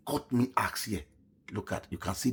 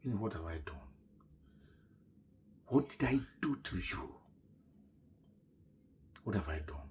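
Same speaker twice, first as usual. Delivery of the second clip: tearful whispered plea; 3 semitones lower, 9 dB quieter than usual. Real, not acted. As I was crying.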